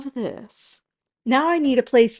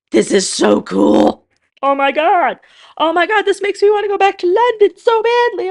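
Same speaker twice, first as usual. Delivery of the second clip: loud, much higher gushing delight; thrilled; 10 semitones higher, 9 dB louder than usual. Real, not acted. Showing viewers her finished Lego bus, thrilled. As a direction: squeal over the finished bus.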